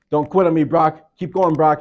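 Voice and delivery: loud, high coach shouting encouragement; coach yelling. Retold or imitated. Coach shouting at his player to keep crawling. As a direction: push him like a coach on the sideline.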